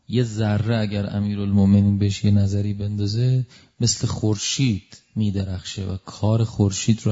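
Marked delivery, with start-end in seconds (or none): none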